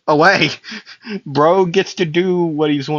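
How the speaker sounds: ordinary talk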